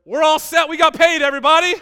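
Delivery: high, very loud celebratory yell; jubilant shout. Pretend. Preacher announces payday to the congregation, jubilant shout. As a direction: holler it out to the whole crowd.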